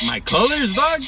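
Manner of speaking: mock gangster comeback. Joke comeback in gangster role.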